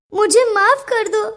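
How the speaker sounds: sobbing through a half laugh; crying apology. Acted. Apologizing to the boy scolded for her sake, teary and choked. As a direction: say sorry through tears.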